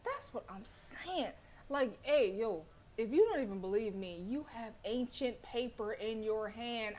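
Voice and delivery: usual speaking voice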